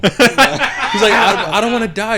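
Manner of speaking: mock the scared victim through laughter; laughing imitation.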